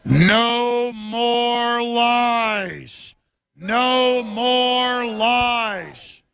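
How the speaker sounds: very slow, stretched bullhorn chant; said real slow. Real, not acted. Alex Jones leading the crowd, chanting very slowly through a bullhorn. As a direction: draw every word out over the megaphone. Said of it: "We gotta say it real slow".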